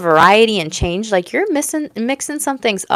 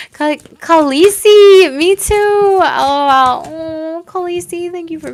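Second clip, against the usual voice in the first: excited; 10 semitones higher, 7 dB louder, slower than usual. Real, not acted. Thanking viewer, high excited.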